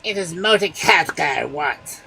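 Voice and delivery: angry ogre. A narrator's reading. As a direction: demand the one you want.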